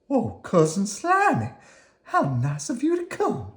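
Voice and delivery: high, welcoming worm voice; Squirmy the cousin. Acted. Squirmy welcomes Cousin Slimy, in a high friendly voice. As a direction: greet your cousin warmly.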